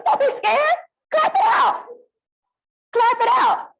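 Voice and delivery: very high, squeaky urging; high-pitched put-on voice. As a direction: urge them on in a playful falsetto. Imitates someone urging clapping, squeaky.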